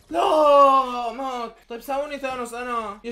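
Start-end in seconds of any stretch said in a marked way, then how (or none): 0.1-1.1 high loud wail of no; real anguish at losing; agonized no; despairing cry. Real, not acted. Teammate dies.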